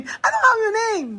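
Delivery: very high, drawn-out teasing repeat; incredulous laughing echo. Retold or imitated. Challenging companion's botched English question, laughing. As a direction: squeal it slowly through laughter.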